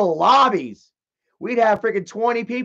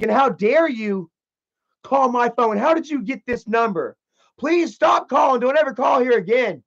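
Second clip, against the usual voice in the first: imitating an indignant woman, higher pitched; mock outrage; 5 semitones higher, 3 dB louder than usual. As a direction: put on a scandalized telephone protest. Woman feigning outrage at caller.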